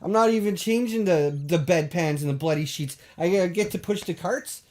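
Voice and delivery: ordinary delivery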